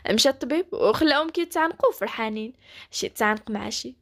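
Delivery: ordinary delivery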